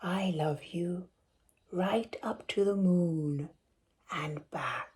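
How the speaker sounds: soft whisper with a smile; tender smiling whisper. Acted. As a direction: whisper it as he lies close. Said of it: "whispered with a smile".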